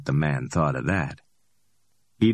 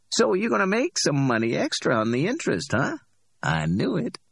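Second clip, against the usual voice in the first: higher, amused street hustler voice; cocky Ordell; 11 semitones higher than usual. Acted. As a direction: play Ordell, high and pleased with himself. Ordell speaks.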